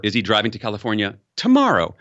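"tomorrow" weighted, brisk lead-in; quick question landing on tomorrow; stress on tomorrow. Shown as a demonstration. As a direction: push the weight onto "tomorrow". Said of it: "I've said that sentence four times".

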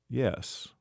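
ordinary delivery, nothing marked

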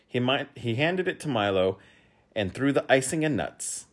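ordinary delivery

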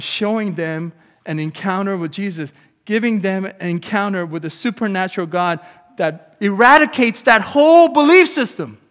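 rising from firm to a loud high cry; building to a shout. Real, not acted. Preacher building from firm to shouting at his congregation. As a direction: build it up until you are shouting at the crowd.

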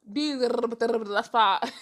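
rattled-off mouth noise with laughter; rapid-fire gibberish. Shown as a demonstration. Host mimics her own fast talking, rapid-fire gibberish. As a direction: babble like a machine, then laugh. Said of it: "the speed in which I talk".

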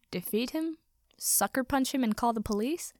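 ordinary delivery

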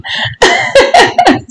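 very high, airy titter; gleeful laugh. A narrator's reading. Lady laughing at her visitor, high and gleeful. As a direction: rattle off a high giggle.

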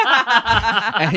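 high, loud laughing narration; laughing too hard to get it out; laughing through words. Real, not acted. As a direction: crack up mid-sentence.

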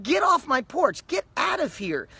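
imitating an irritated homeowner; mock annoyed homeowner. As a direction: shoo a salesman off the porch, half-joking. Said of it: "People are like".

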